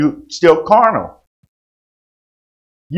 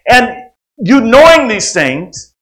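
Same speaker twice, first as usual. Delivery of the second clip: preaching; 8 semitones higher, 7 dB louder than usual. Acted. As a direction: preach it with rising fire. Preaching at full force.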